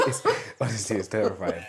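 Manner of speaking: laughing through the words. Real, not acted. Telling a friend about the sneezing sheep, laughing. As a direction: giggle while calling it terrifying.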